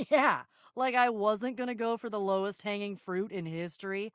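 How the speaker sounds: low, soft sardonic aside; dry aside. Acted. Answering a teammate during the squabble, sardonic. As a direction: drop it low and quiet, drily.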